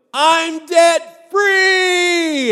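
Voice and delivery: huge high shout, stretched out long; joyful yell. Shown as a demonstration. Preacher shouting as a caller on the show, full joyful yell. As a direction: scream it like a freed caller. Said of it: "Now, I'm gonna yell, so don't get scared here".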